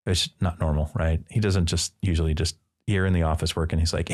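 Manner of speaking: usual speaking voice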